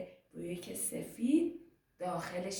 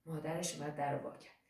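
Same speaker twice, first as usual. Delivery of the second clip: usual speaking voice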